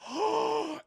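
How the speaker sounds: big high hammed-up gasp; mock gasp. Streamer reacting to the twist for chat, loud high gasp. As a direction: gasp like a soap opera.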